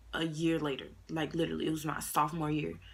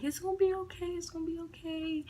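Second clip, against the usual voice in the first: comforting friend; 12 semitones higher than usual. Retold or imitated. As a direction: coo it like a friend calming her down. Friend comforting her, high voice.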